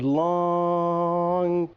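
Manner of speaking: "long" stretched out very long on one level pitch; drawn-out word. Shown as a demonstration. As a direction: hold "long" for seconds to show length. Stretches the word to show length.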